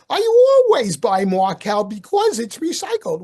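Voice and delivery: put-on mother-in-law in a Queens New York accent. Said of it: "in her Queen's accent".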